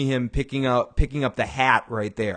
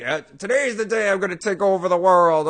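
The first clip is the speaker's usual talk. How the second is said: weak grandiose boast; 7 semitones higher, 4 dB louder than usual. Retold or imitated. Walt voiced, high and odd.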